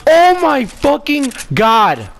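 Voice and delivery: real high, loud scream of triumph; ecstatic screaming. Real, not acted. Player celebrating a quick-scope kill, ecstatic. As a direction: scream it out, pitch way up, stretching the big words.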